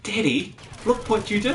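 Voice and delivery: put-on wife chiding dad about the swearing kid; reproachful "look what you did", rising at the end; mock scolding wife. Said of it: "my lovely lady just looked at me with this".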